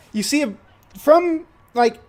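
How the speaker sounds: normal talking voice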